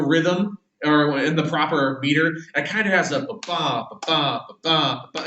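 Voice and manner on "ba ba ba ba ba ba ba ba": da-DUM beat, ba-ba ba-ba, tapped out evenly; even unstressed-stressed ba-ba pulse; rhythmic ba-ba. Shown as a demonstration. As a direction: chant ba-ba like a meter, stress on the second beat. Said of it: "It kinda has this meter to it".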